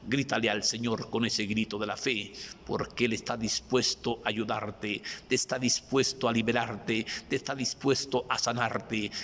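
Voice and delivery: usual speaking voice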